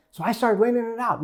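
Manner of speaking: usual speaking voice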